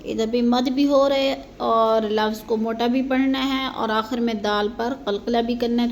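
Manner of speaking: ordinary talk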